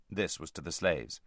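usual speaking voice

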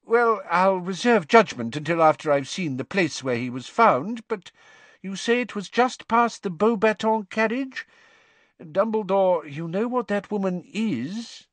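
a fussy minister dismissing concerns; pompous minister. A narrator's reading. Fudge's voice.

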